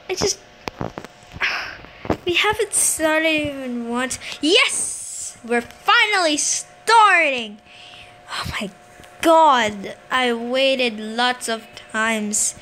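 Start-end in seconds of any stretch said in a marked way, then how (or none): 4.4-7.6 triumphant, drawn-out cheer; excited relief. Real, not acted. Player whose game finally starts, triumphant. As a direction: cheer the long-awaited start, leaning on each word.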